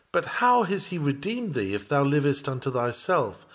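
usual speaking voice

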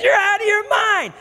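high-pitched mock yell of disbelief; acted outraged shout. Realtor rejecting a one-dollar offer, acted outraged shout. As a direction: shout it as a scandalized realtor would.

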